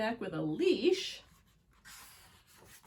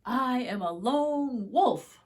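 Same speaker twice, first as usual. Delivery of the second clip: proud wolf; 3 semitones higher, 10 dB louder, slower than usual. Acted. Wolf declares himself.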